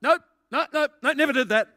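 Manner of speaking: loud, high-pitched mock denial; acted total denial. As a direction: fire off the nopes, loud and high, imitating someone denying it all. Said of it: "the first thing is total denial".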